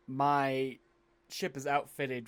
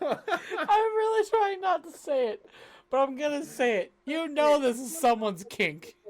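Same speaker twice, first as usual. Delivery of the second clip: laughing; 14 semitones higher, 7 dB louder than usual. Real, not acted. Laughing at the gross description.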